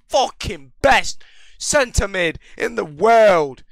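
slow, drawn-out shout of pure hype; ecstatic shout. Real, not acted. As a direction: belt it out like you just pulled the best player. Hyped over a top pack pull.

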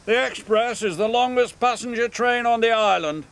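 high, haughty engine boast; sniffy. Acted. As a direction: sniff it out superior. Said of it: "he sniffed".

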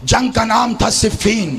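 full-force preaching. Acted. Orator praising Hasan, Husain and Ali, preached at full force.

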